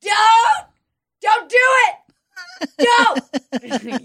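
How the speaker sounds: high shouted warning at a cat; imitating a scream. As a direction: yell it like scolding a pet.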